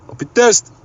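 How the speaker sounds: mock-offended protest; indignant retort. A narrator's reading. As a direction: shoot back at the teasing family. Kees retorts to teasing.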